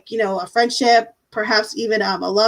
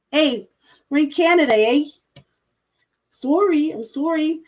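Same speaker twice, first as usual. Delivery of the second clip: a weak, hammed-up Canadian accent; a light mock Canadian accent, leaning on the eh; bad Canadian accent; 4 semitones higher than usual. Joking with viewers in a bad Canadian accent. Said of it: "my Canadian accent's horrible".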